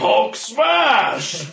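high, loud monster shout; Hulk shout. Acted. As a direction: roar the smash. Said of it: "I'm gonna be Hulk".

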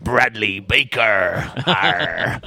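slow, drawn-out pirate growl. Acted. Reading out a patron's name as a pirate, pirate voice. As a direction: say it like a pirate, dropping low on the "arr". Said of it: "we'll do pirate".

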